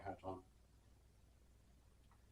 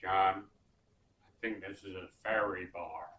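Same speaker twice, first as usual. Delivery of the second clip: lower-pitched imitation of his bandmate; quoting a friend in a slightly deeper voice; Willie's voice; 3 semitones lower than usual. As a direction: lightly voice a friend, pitched down and unhurried. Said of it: "And he said".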